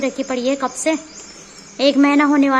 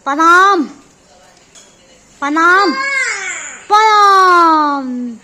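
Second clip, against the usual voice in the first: put-on loud, drawn-out pranam for the child to copy; demo greeting; 6 dB louder, much slower than usual. Shown as a demonstration. Mother showing child how to say pranam, slow and loud. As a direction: stretch the last pranam very slow. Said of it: "प्रणाम कर दो जरा".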